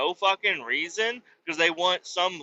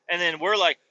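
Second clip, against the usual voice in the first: normal talking voice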